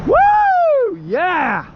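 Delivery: high, loud cheer; excited whoop. Real, not acted. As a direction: whoop in triumph. Celebrating catch.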